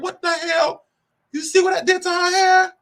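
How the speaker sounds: mock shocked question. Child to landlord about the doll's hair, incredulous.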